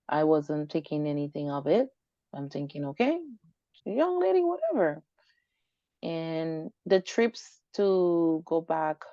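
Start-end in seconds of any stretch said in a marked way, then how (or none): 2.9-5.0 dismissive. Retold or imitated. Retelling her dismissive thought.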